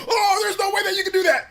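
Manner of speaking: high, loud mock crying protest; disbelieving loser. Streamer voicing the beaten fighter, crying disbelief. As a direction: whine in hammed-up disbelief. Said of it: "and then the person's just like".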